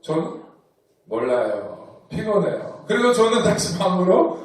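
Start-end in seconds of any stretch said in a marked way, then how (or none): 0.0-2.8 sullen mumble. Retold or imitated. Teen John brushing mom off.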